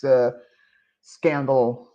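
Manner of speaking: ordinary delivery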